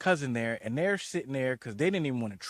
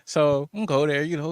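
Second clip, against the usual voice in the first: usual speaking voice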